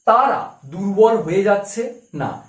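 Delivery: ordinary delivery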